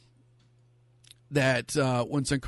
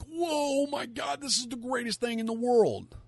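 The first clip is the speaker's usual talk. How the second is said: hammed-up amazement, pitched way up; mock awestruck scream; 10 semitones higher than usual. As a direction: gush in a high, held-back mock scream. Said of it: "scream and jump at me and be like".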